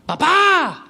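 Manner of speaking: high, drawn-out call to dad; mock shout. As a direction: imitate a kid yelling for dad. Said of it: "porque está gritando".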